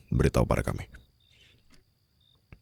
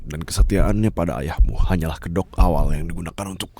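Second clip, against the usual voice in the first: ordinary talk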